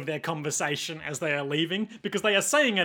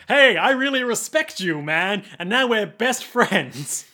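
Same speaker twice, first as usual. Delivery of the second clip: high, loud, gushing buddy voice; mock effusive bonding; 5 semitones higher, 6 dB louder, slower than usual. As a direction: gush it, dragging out the ending. Voices rivals suddenly becoming best friends.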